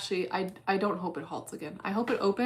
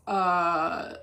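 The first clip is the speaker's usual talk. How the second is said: ordinary delivery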